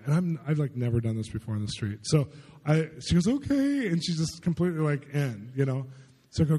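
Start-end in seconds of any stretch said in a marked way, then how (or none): 3.3-3.9 drawn-out, high "okay"; high mimicked girl's agreement; retold high-pitched reply. Retold girl's hesitant reply to prayer.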